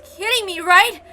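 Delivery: stunned outburst at the hanging bodies; shocked disbelief. Acted. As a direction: refuse to believe what you see. Finds both hanging dead.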